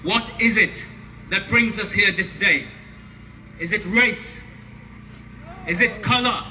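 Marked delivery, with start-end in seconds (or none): 3.6-6.4 shouted call and response; roaring rallying speech. Acted. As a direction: rouse the crowd with questions.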